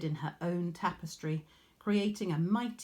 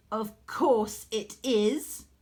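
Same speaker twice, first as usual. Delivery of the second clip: higher, louder, slow smug drawl; 5 semitones higher, 7 dB louder, slower than usual. A narrator's reading. Proud weaver answering the goddess, smug and drawn out. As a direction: savour it, very pleased with herself. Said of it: "Arachne said smugly".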